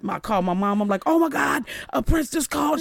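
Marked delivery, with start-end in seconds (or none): 1.1-2.8 breathless excitement, pitched way up; excited gasp. Retold or imitated. Daughter calling her mom about [name], excited and high. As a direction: blurt it out thrilled.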